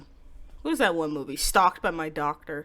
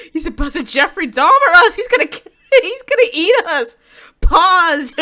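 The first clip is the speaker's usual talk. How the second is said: much louder and higher, yelling through laughter; laughing shout; 12 semitones higher, 16 dB louder than usual. Real, not acted. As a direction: shriek it while cracking up. Shocked at cannibal line.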